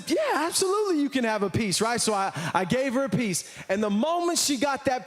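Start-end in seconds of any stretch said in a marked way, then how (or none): 0.1-1.8 eager agreement. Retold or imitated. Dad eagerly giving in to daughter.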